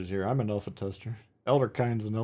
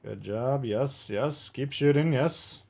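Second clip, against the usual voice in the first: ordinary delivery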